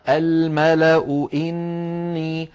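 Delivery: الملأ إني linked, hamza of الملأ voweled u; slow, level chant running الملأ into إني; joined recitation. Shown as a demonstration. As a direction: chant الملأ slowly straight on into a drawn-out إني. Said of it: "توصل هذه الكلمة".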